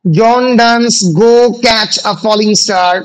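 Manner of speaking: very slow, phrase by phrase; drawn-out dictation with long gaps. Real, not acted. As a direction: dictate slowly, pausing for pens to catch up. Dictating question for students to write.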